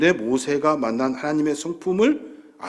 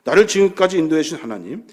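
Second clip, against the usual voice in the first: ordinary talk, nothing marked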